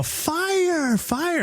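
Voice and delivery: high-pitched imitation of panicked cries; mock screaming. Family at the locked door, mock fire scream. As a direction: falsetto alarm without real volume. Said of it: "fucking screaming".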